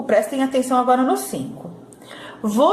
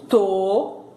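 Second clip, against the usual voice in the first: "To" held long as one separate syllable; isolated syllable; 3 semitones lower than usual. Shown as a demonstration. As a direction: sound out "To" alone, drawn out. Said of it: "outra sílaba". ("To" como sílaba isolada e alongada)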